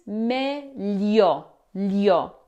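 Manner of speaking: slow "meglio, lio." stressing the gli; "meglio," drawn out, gli spelled out; standard Italian. Shown as a demonstration. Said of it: "in proper or standard Italian, it's always".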